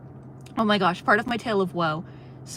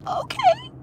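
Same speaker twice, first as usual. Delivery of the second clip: very high squeaked "Okay"; fake-chirpy compliance; shrill mock-cheerful okay; squeaky sarcastic okay. Sarcastic reply to clerk.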